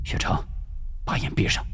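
whispered, protective command; hushed warning. Acted. Father telling daughter to shut her eyes before execution, hushed.

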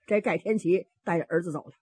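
ordinary delivery

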